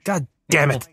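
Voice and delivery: put-on voice of an exasperated actor; hammed-up annoyed star impression; Henry Cavill impression. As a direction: grumble in a mock movie-star voice. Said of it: "That was my impression of, uh, Henry Cavill".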